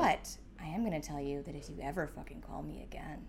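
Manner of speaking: low, quiet, controlled warning; understated menace, voice held down; restrained threat. Retold or imitated. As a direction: deliver the threat softly and evenly. Retells her threat to the man.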